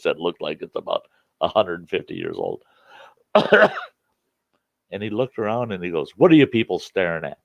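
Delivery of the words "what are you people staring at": loud mock challenge to onlookers; retold loud challenge. Banjo player to the staring bar crowd, loud challenge. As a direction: call it out across the room.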